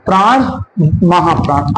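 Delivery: ordinary talk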